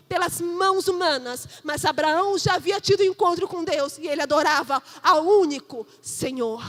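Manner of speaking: loud, emphatic preaching. Acted. Pastor preaching to the congregation, full force. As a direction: proclaim it from the pulpit.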